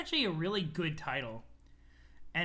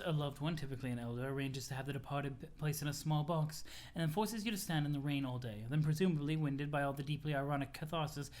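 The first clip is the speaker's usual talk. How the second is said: Rose, dryly formal; 4 semitones lower than usual. Acted. Explaining funerals to friends, low formal delivery.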